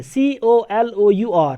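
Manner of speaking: slow letter-by-letter spelling; slow dictation of a spelling; spelled out slowly. Shown as a demonstration. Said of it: "British English में".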